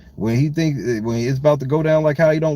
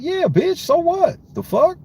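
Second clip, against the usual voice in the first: high, cocky mock voice of the rapper; mocking arrogant rapper; 12 semitones higher, slower than usual. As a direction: sneer it like a smug star brushing off critics. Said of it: "He's so fucking arrogant".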